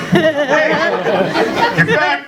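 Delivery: high mocking little voice; the belly taunting him in a high voice; mock squeaky voice. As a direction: tease yourself in a high squeak.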